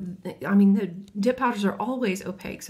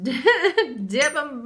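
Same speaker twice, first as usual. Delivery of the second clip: laughing; 5 semitones higher, 5 dB louder than usual. Real, not acted. Laughing at messy dip.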